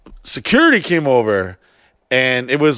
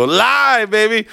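hyped announcement; 6 semitones higher, much slower than usual. Pretend. Hyping his Snapchat.

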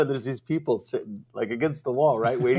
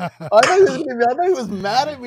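much higher, fast, jittery mock voice; mock scared self; 12 semitones higher, 7 dB louder than usual. Retelling her own fear on the phone call, high panicky mock voice. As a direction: play your past self panicking. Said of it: "I'm like".

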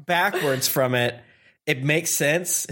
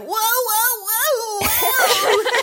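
high, silly cartoonish whoa; goofy dub voice; much slower than usual. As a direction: overact it like a bad dub. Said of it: "the English is like".

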